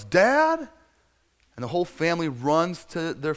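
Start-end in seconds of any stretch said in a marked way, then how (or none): 0.0-0.7 high, loud call to his father; acted shout for Dad; son's yell. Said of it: "looks out and he yells".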